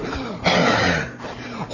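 long, heavy acted sigh; big voiceless exhale of frustration; exasperated sigh. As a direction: let out a worn-out parent's sigh.

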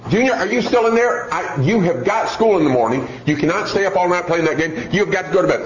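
exasperated parent, each word pressed; increasingly tense mother. Acted. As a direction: scold a child who keeps ignoring you. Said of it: "her voice has gotten increasingly tense each time".

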